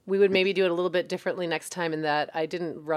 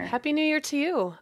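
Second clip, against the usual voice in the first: ordinary talk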